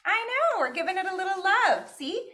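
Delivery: high, tender coo to the tortillas; baby-talk the food, high and sweet. Acted. Cooing over the tortillas.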